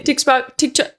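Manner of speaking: "TikTok" garbled as "tiks-pokt", then cut short; tongue-tied stumble. Real, not acted. Vlogger failing to get "TikTok" out, tongue-tied. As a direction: trip over "TikTok" trying to repeat it. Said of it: "I still can't talk".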